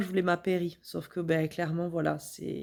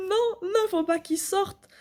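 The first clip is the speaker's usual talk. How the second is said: much higher, frantic no no; desperate, squeaky panic; panicked cry; 13 semitones higher, slower than usual. Retold or imitated. Panic as the baby comes.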